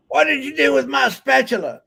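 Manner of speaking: high-pitched pretend complaint about the spatula; mock angry sister. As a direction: squawk it like an irritated sister. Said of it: "when she says".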